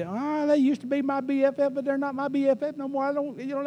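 high, whiny mock complaint about a lost friend; whiny friend imitation. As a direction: play the hurt ex-friend, pitched well up and sulky. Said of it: "You see".

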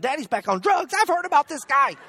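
high mock kids' voices; alarmed kids. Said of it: "my kids had probably done woke up and thinking".

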